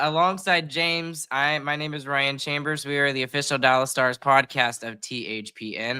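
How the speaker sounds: ordinary talk, nothing marked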